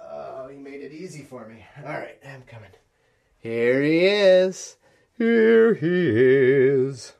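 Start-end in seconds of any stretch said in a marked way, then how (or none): 3.4-7.1 doting on the cat. Real, not acted. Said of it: "found the cat".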